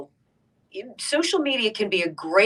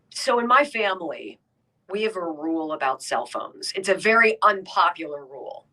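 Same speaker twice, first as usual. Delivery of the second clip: normal talking voice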